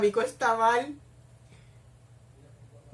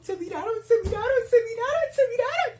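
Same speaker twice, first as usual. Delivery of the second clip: high squeal growing louder and higher; building excitement; 14 semitones higher, 4 dB louder than usual. Real, not acted. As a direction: repeat it in a rising fangirl frenzy.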